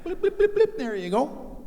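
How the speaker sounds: high chirpy mimicked beeping, then breezy; high beep-beep blips; scanner beeps. Imitating scanner beeps, purple-haired clerk.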